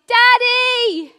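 put-on high, loud child's call; child shouting Daddy. Child at dawn calling her dad, loud and high. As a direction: call out for dad like a scared little girl. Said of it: "So I shouted".

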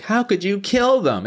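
high-pitched imitation of outrage; mock protest. Mimicking the daughter's protest.

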